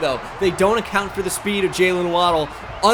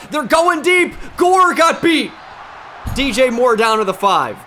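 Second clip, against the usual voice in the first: much higher, louder live yell; alarmed shout; 6 semitones higher, 6 dB louder than usual. Real, not acted. Gamer watching his safety get beaten, alarmed shout. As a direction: yell as the defender gets burned. Live play going wrong.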